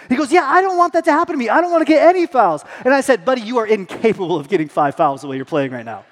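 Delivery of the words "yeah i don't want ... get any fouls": put-on higher, louder, fast worried little boy; voicing his son. Son in the car fretting about fouls, high quick child voice. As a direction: blurt it like an anxious kid.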